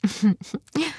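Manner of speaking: light, breathy little laugh; short chuckle. A narrator's reading. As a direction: chuckle briefly before going on. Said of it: "و لبخندی زد و ادامه داد".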